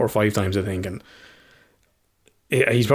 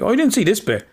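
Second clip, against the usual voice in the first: imitating his son; 10 semitones higher than usual. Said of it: "He's like".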